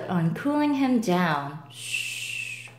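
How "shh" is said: soft unvoiced shush; quiet the others gently; hush. Pelican hushing.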